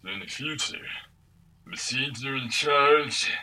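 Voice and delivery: bored, slurred action hero with a major lisp; slow, lisping, bored tough-guy voice; bad Sylvester Stallone impersonator. Podcaster imitating a game's Rambo voice actor, bored and lisping. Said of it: "hearing a really bored Sylvester Stallone".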